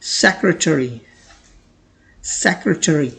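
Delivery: secretary said slowly, "sek-ruh-teh-ree"; drawn-out model of secretary as sek-ruh-teh-ree; correct pronunciation. Shown as a demonstration. Teacher slowly modelling secretary as sek-ruh-teh-ree. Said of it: "इसकी correct pronunciation होती है".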